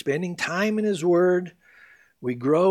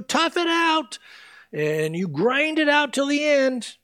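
high, put-on grinding determination; mock straining effort; 10 semitones higher than usual. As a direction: push it out as if toughing it through. Acts out effortful endurance.

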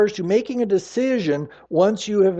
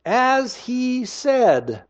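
As, he, said spaced out slowly, each stressed; slow emphatic phrase; 6 semitones higher, much slower than usual. Shown as a demonstration. Preacher pointing out three words in verse six, slow. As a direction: draw out "As he said" word by word. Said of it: "notice the next three little words".

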